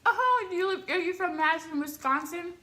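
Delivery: imitated perky celebrity, pitched high; high, bouncy mock-cheerful question; lively, upbeat. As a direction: greet a fan with jokey pep. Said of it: "trying to be, like, lively, upbeat".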